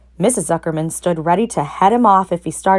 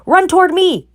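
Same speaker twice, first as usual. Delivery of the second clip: high loud gander yell; 13 semitones higher, 5 dB louder than usual. A narrator's reading. Gander yelling at fleeing pig. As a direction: shout over the crowd. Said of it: "yelled the gander".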